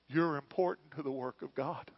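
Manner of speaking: ordinary talk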